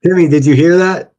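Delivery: loud outraged call across the room; shouting to Timmy. Real, not acted. Calling out to Timmy after the clip, loud and outraged. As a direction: yell it at your cohost in disbelief.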